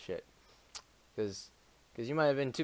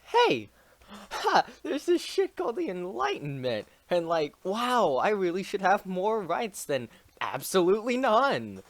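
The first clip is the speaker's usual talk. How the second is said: high, excited mock revolutionary; high-pitched giddy quote; excited Bolivar quote; 6 semitones higher than usual. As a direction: squeal it like a giddy rebel. Bolivar voiced in history retelling.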